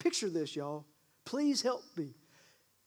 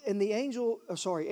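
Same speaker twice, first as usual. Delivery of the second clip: usual speaking voice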